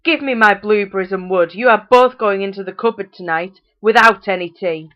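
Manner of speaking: loud furious mother; angry scolding. A narrator's reading. Mother scolding children who forgot their chores, loud. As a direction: rage at them, every word hard.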